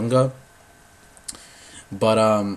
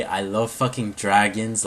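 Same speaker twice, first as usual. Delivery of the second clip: ordinary delivery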